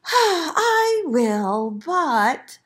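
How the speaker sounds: high, loud, drawn-out reluctance; sighing piglet. A narrator's reading. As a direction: sigh first, then stretch it out. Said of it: "sighed the piglet".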